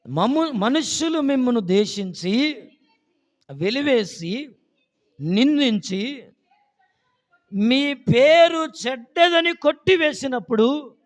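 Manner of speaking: high, loud verse reading with pauses; slow, forceful pulpit reading; declaimed scripture. Real, not acted. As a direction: proclaim it phrase by phrase.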